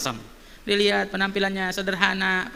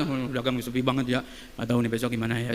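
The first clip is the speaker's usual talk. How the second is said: softer, lower, mock-despondent trader's moan; gloomy grumble; 6 semitones lower, 6 dB quieter than usual. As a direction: sigh it out like a worried seller. Imitating a despairing trader.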